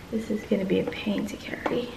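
whispered aside to herself. Real, not acted. Carrying the dishes, whispering a complaint to herself. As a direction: breathe it out under the breath. Muttering under breath.

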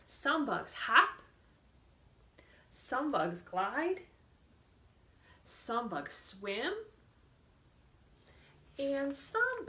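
slow, lilting storybook read for little ones; reading to children. Acted. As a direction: read it slowly to a class of small kids. Teacher reads picture book aloud.

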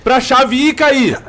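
slow, loud exasperated rant; raging gamer. Real, not acted. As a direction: drag out the furious complaint. Ranting after dying. (reclamando com raiva)

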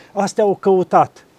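ordinary delivery

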